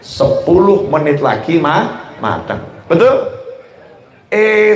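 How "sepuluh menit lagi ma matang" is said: soothing wife. Retold or imitated. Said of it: "Ibu ngomong".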